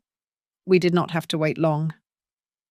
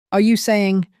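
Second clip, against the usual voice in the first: choked out; 3 semitones higher, 3 dB louder than usual. Acted. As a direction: force the words out past a tight throat. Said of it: "I choked out".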